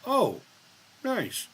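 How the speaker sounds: mock high sing-song "Oh, nice"; quoting her. Streamer imitating how a viewer says nice, high and sing-song. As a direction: lilt it up like the viewer would. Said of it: "I bet you that's how she says it".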